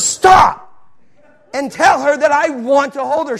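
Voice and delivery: high, loud command; forceful command. Retold or imitated. God ordering the reluctant traveler, loud and forceful. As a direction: cut off the protest with a shouted order. God's acted command to him.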